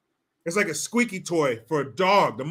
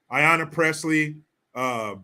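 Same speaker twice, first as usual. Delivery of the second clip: usual speaking voice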